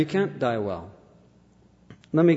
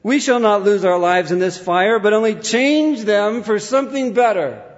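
raised, ringing retold declaration; martyr's address; 6 semitones higher, 4 dB louder than usual. Preacher quotes martyr to fellow martyrs, ringing defiance. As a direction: proclaim it high to fellow martyrs. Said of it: "to his fellow martyrs he said".

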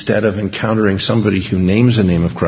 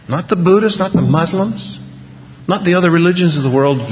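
high, rising preacher's cadence; fiery preaching; 9 semitones higher than usual. Acted. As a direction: build the list like a revival preacher. Preaching at full force.

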